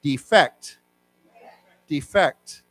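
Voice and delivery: Defect stressed on the second syllable, de-FECT; verb stress. Shown as a demonstration. Teacher leading the class in the verb form, second syllable stressed. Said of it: "let's say it as a person".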